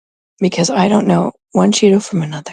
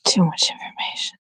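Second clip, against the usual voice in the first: whispered aside; 2 semitones lower, 7 dB quieter than usual. Real, not acted. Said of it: "embarrassed aside".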